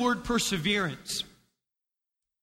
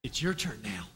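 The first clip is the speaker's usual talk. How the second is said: low hush, much softer; 13 semitones lower, 8 dB quieter than usual. Acted. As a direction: say it quietly as you pass it on. Sermon clip, passing the baton.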